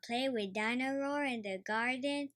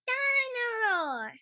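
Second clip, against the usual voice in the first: put-on much higher, louder toddler call; little boy calling happily; George voice; 14 semitones higher, 5 dB louder than usual. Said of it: "says George".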